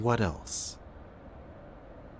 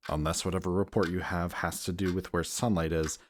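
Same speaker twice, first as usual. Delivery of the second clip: ordinary delivery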